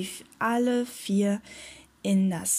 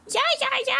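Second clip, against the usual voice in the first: very high gull screech; seagull screeching; much faster than usual. A narrator's reading. Seagull confirming the sighting, screeching. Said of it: "kreischte die Möwe".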